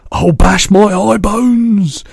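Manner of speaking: high, loud, slow outcry; anguished cry. A narrator's reading. BFG waving the jar in distress, high loud cry. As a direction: bellow it in despair. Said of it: "he cried".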